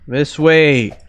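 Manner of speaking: loud call to teammate. Real, not acted. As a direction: shout it across the map. Calling teammate louder.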